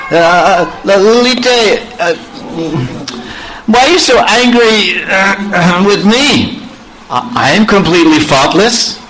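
high, pleading lover's voice, choked with emotion; Krishna pleads with Lalita at the gate, choked up. Acted. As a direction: beg Lalita through a tight throat. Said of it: "Krishna became all choked up".

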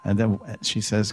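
ordinary talk, nothing marked

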